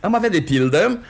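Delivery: high, loud salesman's announcement; proclaiming. Acted. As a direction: proclaim the catalogue number loudly. Matchmaker announces catalogue entry grandly.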